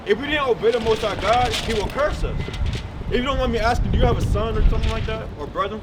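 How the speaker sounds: normal talking voice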